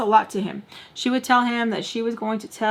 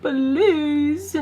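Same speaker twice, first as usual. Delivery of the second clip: high, whiny fake begging; mock pleading; 6 semitones higher than usual. Granddaughter mimicking grandma's plea, mock begging. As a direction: beg in a put-on, tearful whine.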